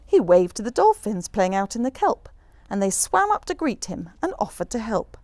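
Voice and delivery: usual speaking voice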